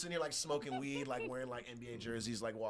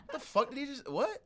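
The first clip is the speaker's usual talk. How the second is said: baffled; 14 semitones higher, 4 dB louder than usual. Retold or imitated. Baffled by fast accent.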